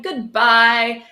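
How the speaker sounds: ordinary delivery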